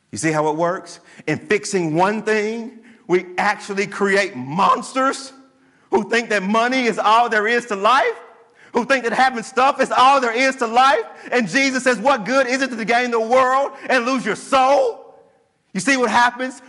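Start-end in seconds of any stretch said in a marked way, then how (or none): 5.9-16.6 rising preaching. Acted. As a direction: build the sermon up to a pointed question. Preacher builds to full force.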